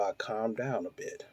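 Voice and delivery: ordinary talk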